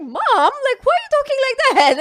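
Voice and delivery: high, loud, rushed plea to mom; exasperated protest. Retold or imitated. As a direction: protest like a mortified daughter. Daughter protests at mom's grim talk.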